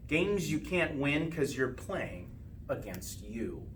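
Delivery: usual speaking voice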